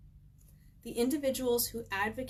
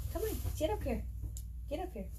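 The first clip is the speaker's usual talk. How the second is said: higher, lightly coaxing call; calling the dog; 8 semitones higher, much faster than usual. Real, not acted. Speaker calling her dog up beside her, higher coaxing voice. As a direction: coax the dog up onto the seat.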